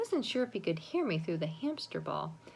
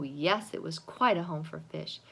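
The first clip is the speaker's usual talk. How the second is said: ordinary delivery, nothing marked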